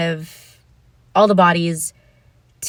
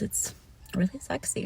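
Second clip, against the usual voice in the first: whispered aside; 2 semitones higher, 13 dB quieter than usual. Real, not acted. Vlogger telling viewers her preference in a whisper. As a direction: whisper "it's really sexy" very softly.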